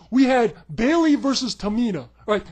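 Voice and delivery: ordinary delivery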